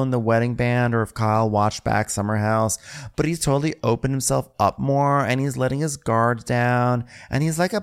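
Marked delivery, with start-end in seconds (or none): none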